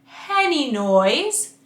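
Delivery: slow Henny noise, air before the vowel; breathy H onset. Shown as a demonstration. Coach showing an airy h start on Henny noise. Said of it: "Try that".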